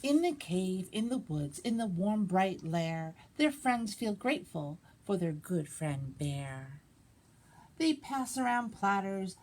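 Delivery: put-on lively read-aloud for children; animated picture-book reading; story narration. A narrator's reading. Librarian reads picture book.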